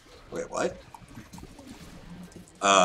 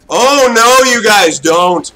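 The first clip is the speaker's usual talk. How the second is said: raised, taunting no; loud taunt; 4 semitones higher, 6 dB louder, slower than usual. Real, not acted. Gamer taunting the enemy team, loud. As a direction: call out the enemies loudly. Taunting enemies mid-fight.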